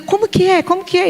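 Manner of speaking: high, astonished question; stunned teacher asking again; surprised. Retold or imitated. Amazed at kids' story.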